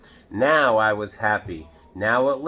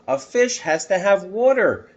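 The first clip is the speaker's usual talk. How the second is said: put-on higher, louder urgent child voice; boy's urgent plea; 8 semitones higher, 5 dB louder than usual. Boy insisting to the policeman, higher and urgent. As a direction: plead like a worried boy. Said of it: "I said to the policeman".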